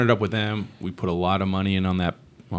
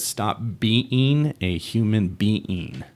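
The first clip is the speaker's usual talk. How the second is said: slow, spaced-out delivery of a title; deliberate book title; 2 semitones higher, much slower than usual. Acted. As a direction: stretch it out slowly, like announcing a title. Announcing his imagined book title.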